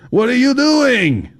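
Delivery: very high, slow alarmed cry; distressed. Acted. Fat man distressed at Kyle's crossed-out page, very high. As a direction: panic over the ruined page.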